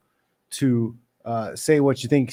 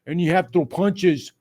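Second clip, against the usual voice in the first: a hint of a whiny mocking voice; mocking commenter; 4 semitones higher than usual. Parodying a stand-up-only fan, lightly mocking. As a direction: sneer like a know-it-all fan. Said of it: "Not this".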